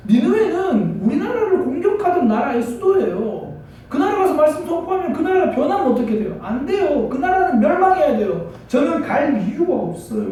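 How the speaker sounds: high-pitched, indignant objection; protesting refusal. Acted. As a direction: argue back like a reluctant prophet. Acting out Jonah refusing God's command.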